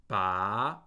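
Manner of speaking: Ba rising from a low start; second tone on Ba. Shown as a demonstration. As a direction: let "Ba" climb steadily upward at the end. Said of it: "That's the second tone".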